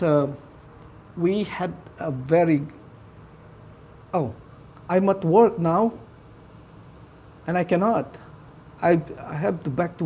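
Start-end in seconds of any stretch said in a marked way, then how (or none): none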